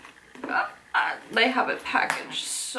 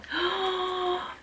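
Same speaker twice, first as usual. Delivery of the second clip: high, soft, long gasp; excited gasp; 11 semitones higher, 6 dB quieter than usual. Real, not acted. Moving on to the next item, excited gasp. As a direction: catch your breath in excitement.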